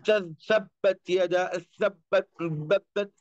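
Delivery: तब्बत said wrongly, ta not from its proper place; wrong pronunciation demo. Shown as a demonstration. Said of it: "तो इस तरीके से गलत हो जाएगा". (तब्बत का गलत उच्चारण)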